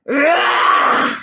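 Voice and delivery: harsh enraged roar; rough, raging monster cry. A narrator's reading. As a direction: roar like a beast waking. Monster roars back to life.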